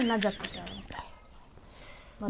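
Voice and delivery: slow stubborn whisper; whispered refusal. Acted. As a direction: breathe the refusal out slowly.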